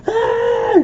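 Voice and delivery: high, loud startled scream; scared scream. Retold or imitated. Scared man seeing the dead rise, high shriek. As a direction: shriek like you've been jumped. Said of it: "the guy got really scared and was like".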